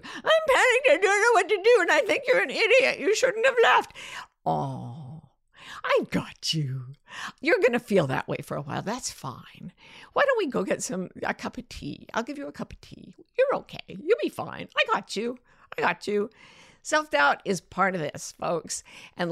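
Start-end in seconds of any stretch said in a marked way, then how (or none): 0.0-3.9 high, loud, frantic voice of self-doubt; panicked self-doubt. Acted. Self-doubt panicking at the listener, high and frantic. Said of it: "see it as part of yourself". 4.5-16.3 gently calm a frightened friend; reassuring. Acted. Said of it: "Hello, self-doubt".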